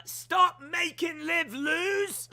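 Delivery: slow, high put-on voice; a hint of a pleading child; voicing Harley. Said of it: "says, uh, Harley".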